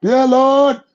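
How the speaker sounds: high, loud cry to the Lord; desperate cry. Retold or imitated. Man hanging from a branch calling God, high loud cry. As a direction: cry out in panic.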